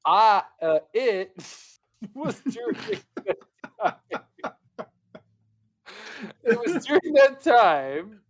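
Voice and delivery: high and loud, giggling through words; fighting off the giggles; laughing while reading. Real, not acted. Reader can't stop laughing.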